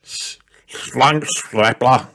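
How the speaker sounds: slow, garbled mumble with a mouthful of foam; dad mumbling while brushing his teeth; mumbled through toothpaste. A narrator's reading. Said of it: "mumbled Daddy Pig through a mouthful of toothpaste".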